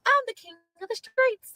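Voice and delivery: a smug mock boast; mocking impression. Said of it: "you were like".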